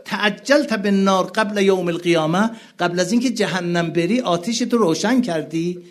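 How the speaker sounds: high, loud mourning recitation; impassioned preaching. Acted. Preacher crying out to the mourning gathering, impassioned. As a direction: cry it out to the mourners.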